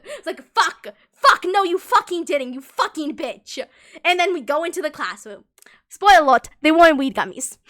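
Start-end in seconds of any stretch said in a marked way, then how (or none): 0.5-3.6 loud, slow retold swearing; mock furious cursing. Cursing the friend who claimed weed gummies, loud and slow. Inner panic after weed-gummy prank. 5.9-7.6 loud reveal. Real, not acted. Punchline reveal to viewers.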